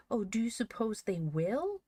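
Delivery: high, anxious question; terrified cry. A narrator's reading. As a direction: ask it with rising dread. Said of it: "cried Joel in terror".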